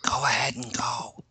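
breathy whispered go-ahead; whispered urging. Acted. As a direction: urge them on in a whisper. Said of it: "says Tharsteline".

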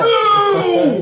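sharp alarmed no; shout. Real, not acted. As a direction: yell it in alarm. GM reacts to kill-all plan.

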